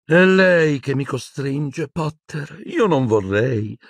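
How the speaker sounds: high, slow, fretful villainess; uneasy villainess muttering. A narrator's reading. Umbridge blaming Potter, uneasy and drawn-out. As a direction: draw it out in a high reluctant voice. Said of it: "continuò a borbottare, dimenandosi inquieta".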